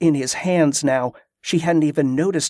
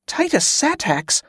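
Titus Satax? asked high in disbelief; stunned recognition; 11 semitones higher than usual. A narrator's reading. Carla realizing who he is, stunned. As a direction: shoot the name up as it clicks. Suddenly recognizing the man's name.

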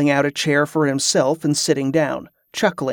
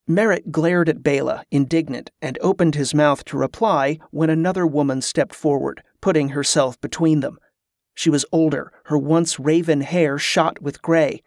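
plain reading voice

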